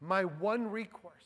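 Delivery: normal talking voice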